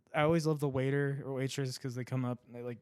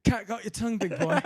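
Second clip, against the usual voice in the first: 1 mock taunt; 8 semitones higher, 4 dB louder than usual. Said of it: "taunting the old man".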